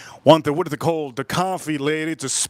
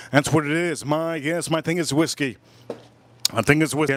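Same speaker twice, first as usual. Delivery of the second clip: ordinary talk, nothing marked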